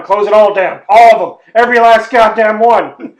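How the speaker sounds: loud, escalating commands; mock tyrant order. Government goon ordering every restaurant shut, building shout. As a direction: order every closure like a petty despot.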